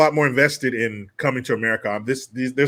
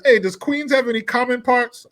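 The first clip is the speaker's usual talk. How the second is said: movie cab passenger voice; 8 semitones higher than usual. Retold or imitated. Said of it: "And he says".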